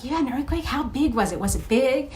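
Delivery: high, eager rapid questions; excited Michael. Retold or imitated. Michael on the phone begging for quake details, excited. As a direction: pepper her with thrilled questions. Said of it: "He got so excited".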